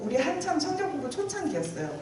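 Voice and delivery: normal talking voice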